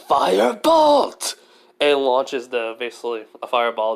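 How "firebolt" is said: loud, high battle cry of a spell name; spell shout. Acted. Belle blasting the monster with a spell, loud high shout. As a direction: yell the spell out with full force, stretched. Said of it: "he yells out".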